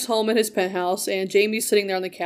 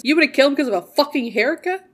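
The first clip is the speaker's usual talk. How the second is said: higher, louder disbelieving question; mock incredulity; 6 semitones higher, 5 dB louder than usual. Podcaster imitating a sister doubting his reason to kill, incredulous. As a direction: retell it as an exasperated, can't-believe-it retort. Voicing Deb's incredulous retort.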